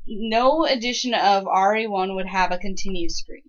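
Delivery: ordinary talk, nothing marked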